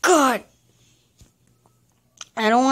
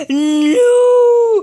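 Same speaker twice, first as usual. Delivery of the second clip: high, loud cry of frustration; real frustrated shout at the game; 11 semitones higher, 8 dB louder than usual. Real, not acted. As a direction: yell no as the climb is lost.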